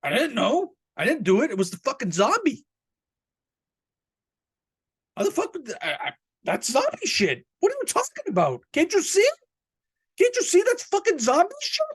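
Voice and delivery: mock-outraged squeal of innocence; shrieking indignant defence, it was the zombie; indignant whine. Pleading innocence over zombie excuse.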